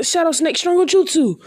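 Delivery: high shouted technique name; jutsu battle cry. Acted. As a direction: announce the attack like an anime fighter. Calling out the jutsu mid-fight.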